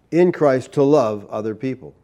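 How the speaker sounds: ordinary talk, nothing marked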